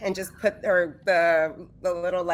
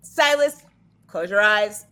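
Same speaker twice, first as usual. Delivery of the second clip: raised voice, calling out briskly; back to reality; 5 dB louder than usual. Acted. Feruza getting out of the tub warns Silas, calling out. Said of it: "comes back to reality, and she's like".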